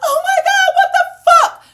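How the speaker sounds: very high, loud scream; full-volume acted-out outburst; re-enacted shock. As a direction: shriek it in disbelief at the wreck. Reaction on getting out.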